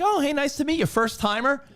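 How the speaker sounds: high-pitched mock office welcome; imitated friendly greeter. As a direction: greet a newcomer in a high receptionist voice. Imitating school office staff greeting him.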